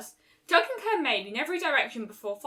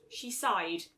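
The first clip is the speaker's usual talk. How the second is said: ordinary delivery, nothing marked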